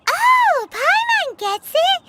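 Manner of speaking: high bright "oh" of discovery; the penny drops, loud and high; sudden realization. Acted.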